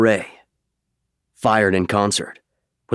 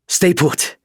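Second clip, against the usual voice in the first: higher and louder pilot calling over the radio, with a relieved sigh in the middle; pilot on comms; 10 semitones higher, 3 dB louder than usual. Acted. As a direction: call out over the comm, relieved to find him.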